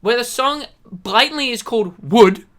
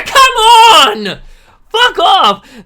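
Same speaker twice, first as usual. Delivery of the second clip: high, loud, cracking shout; raging shout; furious yell; 11 semitones higher, 13 dB louder, much slower than usual. Real, not acted. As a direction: scream it in disbelief. Raging at a fan's review.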